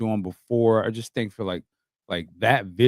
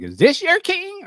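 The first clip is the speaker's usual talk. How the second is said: mocking kids on social; 4 dB louder than usual. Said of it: "be like".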